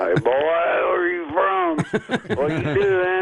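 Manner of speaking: slow, high mock country dad; gruff overprotective dad. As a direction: drawl it out like a suspicious father. Playing dad grilling the boyfriend.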